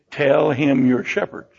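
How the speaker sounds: low, slow and deliberate; slow instruction. Acted. Joseph coaching his family for Pharaoh, slow and deliberate. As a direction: spell out the instruction slowly. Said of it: "And so he says".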